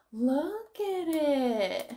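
slow breathy gasp of delight; gasping at how good it looks; awed gasp. Real, not acted. Showing viewers her tidy office, awed gasp.

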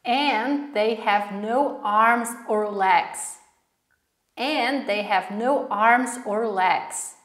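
slow, spaced-out words; slow model reading. Shown as a demonstration. Teacher repeats the recorded line for learners, slowly word by word. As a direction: draw the phrase out so learners can follow.

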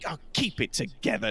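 hushed in-character telling-off; muttered rebuke. Acted. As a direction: scold under your breath, in character. Dwarf scolds the bellhop aside.

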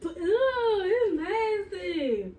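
disgusted, high and loud; gagging at the taste; mouth burning from spicy food. Real, not acted.

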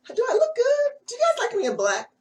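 coy high question to viewers; teasing, fishing for compliments; playful flirty ask. Real, not acted.

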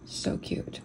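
breathy whispered coo; whispering. Real, not acted. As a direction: whisper it fondly over a cute picture. Cooing over cute pupper.

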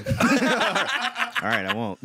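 high laughing reply. Real, not acted. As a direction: laugh it out while agreeing.

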